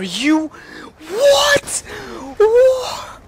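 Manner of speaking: high startled shouts of disbelief; shocked yell. Real, not acted. Sudden kill shock in game.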